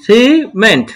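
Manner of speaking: "Cement" with a gap after "সি"; "cement" in two distinct pieces; broken into syllables. Shown as a demonstration. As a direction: hold "সি" high, then drop on "meant". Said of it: "syllable অনুযায়ী ভেঙে ভেঙে".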